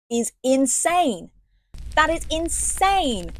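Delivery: drawn-out, indignant insane; outraged. Real, not acted. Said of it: "outraged rant".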